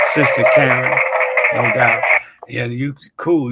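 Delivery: ordinary delivery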